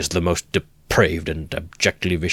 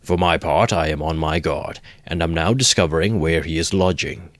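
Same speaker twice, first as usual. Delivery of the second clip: ordinary delivery, nothing marked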